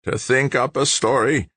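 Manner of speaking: high-pitched suspicious growl. A narrator's reading. Big George doubting the stranger, growling. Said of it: "growled Big George".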